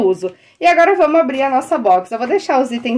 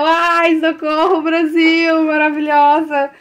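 high, excited cry; thrilled, raised-voice outburst; thrilled exclamation; 5 semitones higher, slower than usual. Real, not acted. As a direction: gush with delight at a gift. Thrilled at the gift.

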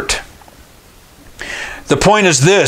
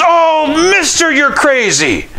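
shocked shout; 12 semitones higher, slower than usual. Retold or imitated. Stranger shouting at the father after the near miss, high shocked shout. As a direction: blurt it out, rattled.

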